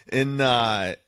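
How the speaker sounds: ordinary delivery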